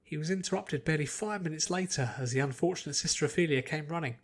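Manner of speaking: ordinary delivery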